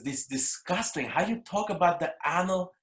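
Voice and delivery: slow, high Russian-accented mom impression; imitating disgusted Russian mother. Son mimicking his mom appalled by his stand-up act, disgusted Russian accent. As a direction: scold slowly in a put-on Russian mother's accent. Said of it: "She goes".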